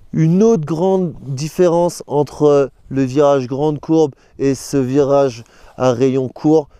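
ordinary talk

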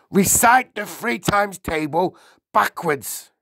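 high, loud barked order; bullying headmistress command. A narrator's reading. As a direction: bark it like a drill-master. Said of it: "the Trunchbull barked".

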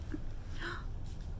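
a quick startled intake of breath; storyteller's gasp; sharp gasp. Pretend. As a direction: gasp as if shocked. Reacting to confusing letter.

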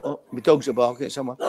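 ordinary talk

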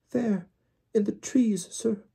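very high, squeaky timid elf voice; a scared servant stammering; frightened house-elf. Acted. Winky answering Mr Weasley, timid squeaky elf.

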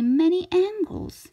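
ordinary delivery, nothing marked

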